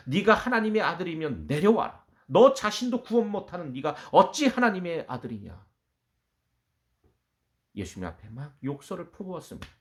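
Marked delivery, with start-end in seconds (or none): 0.1-5.7 loud, raised jeering challenge; mocking taunt. Condemned prisoner taunting Jesus on the cross, loud mockery. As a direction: hurl it as a scornful insult. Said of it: "예수님을 조롱하며 욕하기 시작했습니다".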